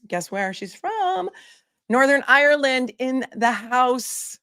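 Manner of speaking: slow, high teasing build-up; drawn-out tease. Real, not acted. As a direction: build the reveal slowly. Announcing winner's country.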